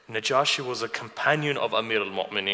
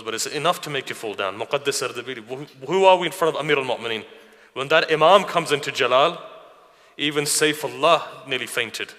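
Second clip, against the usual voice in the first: loud, raised preacher's voice; impassioned preaching; 5 semitones higher, 11 dB louder than usual. Acted. Preacher awing the congregation with the Imam's Jalal, impassioned. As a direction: preach it at full force, voice lifted high and loud.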